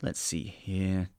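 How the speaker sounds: usual speaking voice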